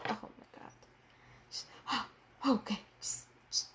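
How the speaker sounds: whispered in disbelief, with a gasp; hushed, gasping disbelief; stunned whisper. Real, not acted. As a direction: breathe the words out, too shocked to speak aloud. Overwhelmed reacting to chapter.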